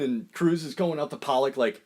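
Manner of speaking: ordinary delivery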